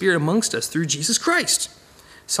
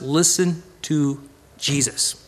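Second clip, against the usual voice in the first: God's voice; 3 semitones lower, much slower than usual. Retold or imitated. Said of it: "And God says".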